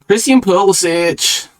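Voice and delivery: high, drawn-out name call; playful name announcement. Pretend. Host introducing the next footballer to rate, playful high drawn-out announcement.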